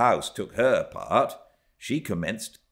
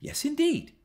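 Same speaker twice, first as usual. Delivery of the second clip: firm reprover. A narrator's reading. Reprover answers the girl.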